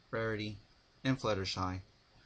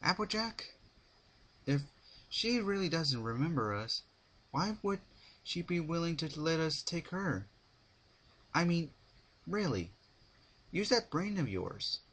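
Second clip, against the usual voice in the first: higher, irritated mare voice; snapping Rarity; 8 semitones higher than usual. A narrator's reading. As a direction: snap at her friend, pitched up. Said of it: "snapped Rarity".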